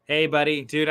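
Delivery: usual speaking voice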